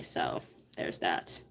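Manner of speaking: whispered aside; breathy whispered afterthought. Real, not acted. Trailing off.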